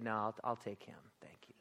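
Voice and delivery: soft whisper; hushed, gentle and firm. Acted. Jesus speaking gently.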